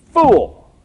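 sharp high yell of "Fool!"; shouted insult. Acted. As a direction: spit out the insult loudly. Acts out calling brother a fool.

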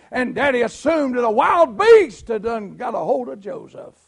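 loud, driving storyteller preacher; preacher telling the brothers' crime to the congregation; fervent preaching. Acted. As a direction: pound out the betrayal story. Preaching at full force.

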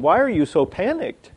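high-pitched, untroubled bafflement; Jesus. Acted. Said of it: "I wonder if Jesus looks at our lives and said".

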